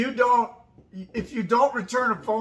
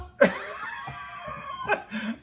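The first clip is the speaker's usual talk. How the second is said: big burst of laughter. Real, not acted. Laughing hard when asked if she will keep the dog. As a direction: let the laugh burst out high.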